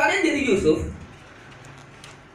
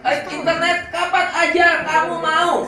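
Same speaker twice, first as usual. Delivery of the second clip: doting parent; 3 semitones higher, 6 dB louder than usual. Retold or imitated. Parent voiced to child.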